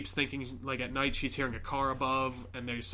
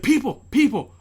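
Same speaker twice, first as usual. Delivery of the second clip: high, loud cries for help; desperate calling; 11 semitones higher, 12 dB louder than usual. Retold or imitated. Girl calling to people in the car, shouting. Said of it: "She's like".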